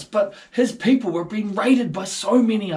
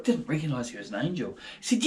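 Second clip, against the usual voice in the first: normal talking voice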